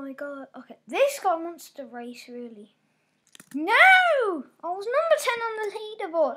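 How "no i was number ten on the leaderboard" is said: dismayed outburst. Real, not acted. Dismay at losing rank.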